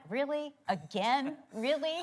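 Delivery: high-pitched mock exasperated questions; playfully fed-up, rising disbelief; mock incredulous. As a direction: act out disbelief at the same old answer. Mock exasperation at husband's usual answer.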